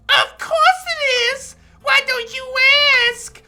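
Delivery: put-on high, slow, loud girlish voice. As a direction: squeak it like an excited girl. Voicing Natsuki.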